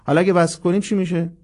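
ordinary talk

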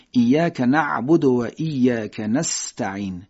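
"نستعین" slowly with the س clearly hissed; don't hide the س in "نستعین". Shown as a demonstration. Teacher reciting slowly so the سین whistle shows. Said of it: "این صفیر سین هست".

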